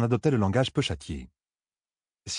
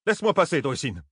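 high-pitched, irritated command dropping on the name; growled order; 7 semitones higher than usual. A narrator's reading. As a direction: order her aside crossly. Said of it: "grogna Thalène". (ordre grogné, agacé)

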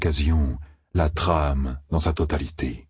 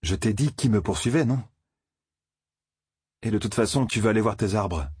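voix de jeune homme plus aiguë, sur la défensive; Rand, un peu plus haut, méfiant et pressé; 5 semitones higher than usual. Acted. Rand répond à Loial. (Rand repousse Loial, voix un peu plus aiguë)

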